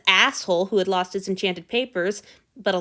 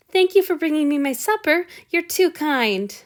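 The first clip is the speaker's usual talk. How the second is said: honey-sweet; 8 semitones higher, 5 dB louder than usual. A narrator's reading. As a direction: pour on the charm. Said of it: "as sweet and smooth as fine honey".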